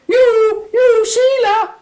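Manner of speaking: very high, loud calling out; hammed-up shout to a friend. A narrator's reading. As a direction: call across the graveyard. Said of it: "she shouted".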